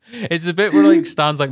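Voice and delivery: usual speaking voice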